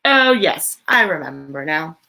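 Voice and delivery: usual speaking voice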